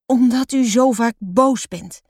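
usual speaking voice

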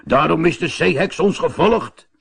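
conclude it gravely; helmsman. A narrator's reading. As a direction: helmsman explaining to the sailors. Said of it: "zei de stuurman".

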